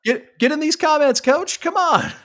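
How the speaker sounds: high, loud hype calling out; cheering someone into the comments; excited call-out. Real, not acted. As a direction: shout it up to the coach, pumped. Calling out to the coach.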